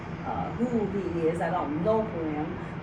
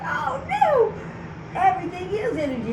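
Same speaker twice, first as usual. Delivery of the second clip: high amazed outburst; 12 semitones higher than usual. Retold or imitated. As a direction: blurt it, blown away, pitched up. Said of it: "I was like".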